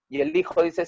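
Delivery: ordinary delivery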